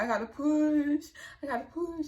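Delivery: pleading that she must push now; urgent plea. Retold or imitated. Urgent labor plea to mama.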